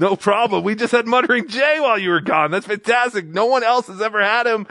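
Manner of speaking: high, loud eager bragging; hammed-up excitement; excited. Excited news to returning cohost.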